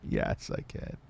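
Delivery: low, whispered villain gloat; evil voice. Pretend. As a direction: gloat it like a villain with a dark chuckle. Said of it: "Why did I say that in such an evil voice?".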